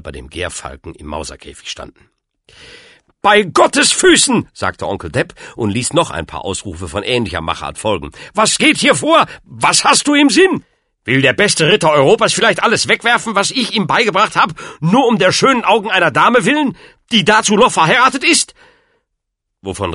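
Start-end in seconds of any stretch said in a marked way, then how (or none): 3.2-4.5 high, loud, drawn-out oath; slow, scandalized old uncle's cry; outraged exclamation. A narrator's reading. Outraged uncle scolds his pupil. 8.3-18.5 high, loud tirade from the uncle; indignant, shouted reproach; outraged scolding. A narrator's reading. Uncle angrily takes pupil to task.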